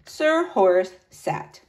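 ordinary delivery, nothing marked

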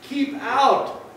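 high-pitched storybook yell. A narrator's reading. Grumpy cactus chasing off the tortoise, yelling. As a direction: yell to drive someone off. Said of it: "yelled Hank".

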